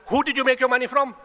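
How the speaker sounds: high, loud rhetorical demand; forceful challenge. Real, not acted. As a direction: throw the question hard at the crowd.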